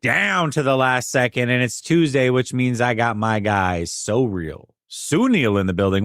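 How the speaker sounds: drawn-out, punchy show intro; radio host voice. Real, not acted. Host welcomes listeners back and introduces his guest, radio host voice. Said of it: "you turn into your radio host voice".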